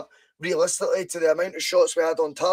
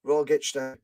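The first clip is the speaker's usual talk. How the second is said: ordinary delivery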